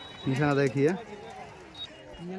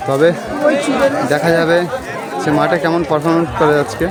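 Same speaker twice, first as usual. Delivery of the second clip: loud announcer voice, louder; race commentary; 7 semitones higher, 11 dB louder than usual. Acted. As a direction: call it to the crowd. Opening horse race commentary. (ঘোড়দৌড়ের ধারাভাষ্য)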